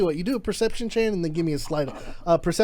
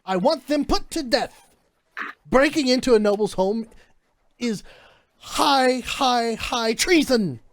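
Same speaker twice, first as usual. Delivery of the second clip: higher, stammering outrage, slowing on treason; flustered demand; 6 semitones higher, slower than usual. Acted. A hint of a flustered noblewoman demanding execution from the guard. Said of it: "she seems flustered, like, super flustered".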